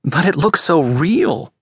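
high, drawn-out plea; dismayed, slightly begging protest; almost pleading. A narrator's reading. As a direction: plead in disbelief, voice lifted. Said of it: "almost pleading".

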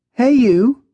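high lilting greeting; lyrical voice. A narrator's reading. Maya greets Jack on the balcony, lyrical. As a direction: sing out a light, high hello. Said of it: "comes her lyrical voice".

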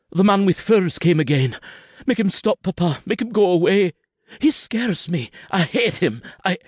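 put-on high, rushed frightened child voice; a scared little boy pleading with his papa; frightened boy's voice, pitched high; young boy Kenzie. A narrator's reading.

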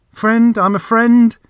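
high, loud, flustered correction; spluttering. A narrator's reading. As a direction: blurt it out in a fluster. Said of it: "he spluttered".

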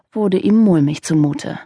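ordinary delivery, nothing marked

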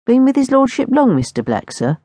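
a new footman asking deferentially; eager young footman. A narrator's reading. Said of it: "asked John".

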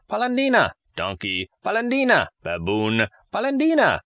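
high, sing-song teasing name; shrill mock jeer; mocking taunt. A narrator's reading. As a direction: taunt him with the nickname. Taunting Geppetto with nickname.

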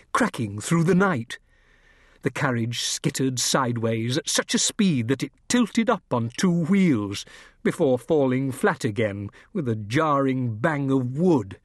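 plain reading voice

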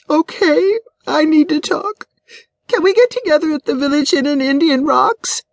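high-pitched, needy wife's voice; distressed wife. A narrator's reading. Upset wife asks her friend to meet up, high and distressed. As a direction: speak up high, as if fresh from crying.

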